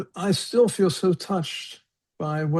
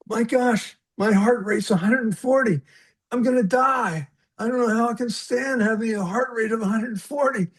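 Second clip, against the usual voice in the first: panicked; 7 semitones higher than usual. Retold or imitated. Retold panic over heart rate.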